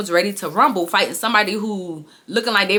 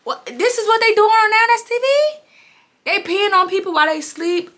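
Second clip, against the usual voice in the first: disgusted disbelief; 12 semitones higher than usual. Retold or imitated. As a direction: recoil in horror at the news. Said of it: "And I said".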